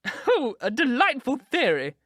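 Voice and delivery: high, slow, thrilled cry; delighted exclaim. A narrator's reading. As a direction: gush with delight at the idea. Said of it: "she exclaimed".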